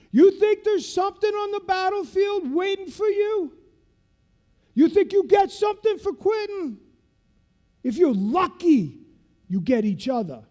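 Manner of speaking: high, loud rallying shout; retold instructor's shout; fierce address. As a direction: drive the lesson into the class.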